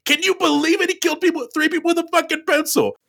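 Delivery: hammed-up incredulous outburst, very high-pitched; mock amazement in a high cartoonish voice; Peter Stormare's enthusiasm. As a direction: imitate a wildly excitable storyteller way up high. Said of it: "Peter Stormare's enthusiasm for this story".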